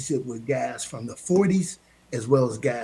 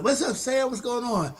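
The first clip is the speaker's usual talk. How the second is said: quote; 9 semitones higher than usual. Retold or imitated. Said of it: "they be like".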